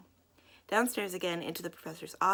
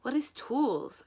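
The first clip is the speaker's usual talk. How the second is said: befuddled; 7 semitones higher, 4 dB louder, slower than usual. Acted. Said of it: "asked the befuddled young man".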